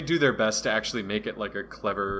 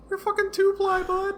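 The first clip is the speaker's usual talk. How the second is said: much higher mock Shoresy chirp; cocky hockey trash talk, pitched way up; Shoresy impression; 4 dB louder than usual. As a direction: chirp him like Shoresy would. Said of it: "He has a Shoresy accent".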